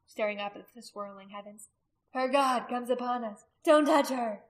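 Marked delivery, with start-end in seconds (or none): 2.1-4.4 loud, raised-pitch cries to the crowd; escalating shouted warning; urgent outcry. A narrator's reading. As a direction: call out the danger urgently. Frantic outcries before the crowd.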